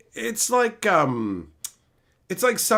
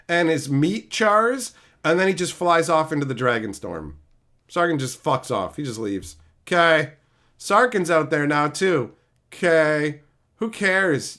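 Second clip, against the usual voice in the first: usual speaking voice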